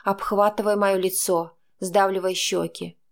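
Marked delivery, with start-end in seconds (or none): none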